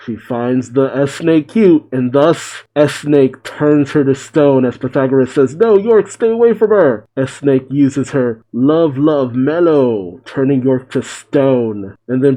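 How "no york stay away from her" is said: high, urgent storyteller's warning. A narrator's reading. Pythagoras warns York away from S-Snake, urgent high-pitched cry. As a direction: call out to stop her, pitch raised.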